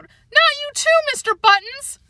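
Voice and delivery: high despairing wail for the dog; horrified cry. Acted. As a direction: cry it out, heartbroken. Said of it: "she cried out".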